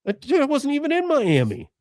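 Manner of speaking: high-pitched, incredulous denial; protesting accused man. Acted. A man wrongly accused of a stabbing, protesting. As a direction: act out a baffled, indignant denial.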